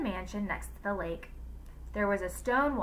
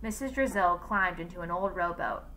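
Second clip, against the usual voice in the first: ordinary delivery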